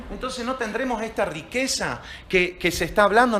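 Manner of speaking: ordinary delivery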